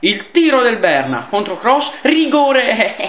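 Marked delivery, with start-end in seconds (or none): 2.0-3.0 excited shout. Real, not acted. Penalty won during match.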